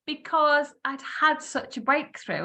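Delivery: ordinary talk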